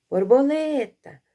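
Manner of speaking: high, loud call with a long stressed LE in Borboleta. Shown as a demonstration. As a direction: call Borboleta as if it were outside. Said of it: "Você ia chamar em voz alta".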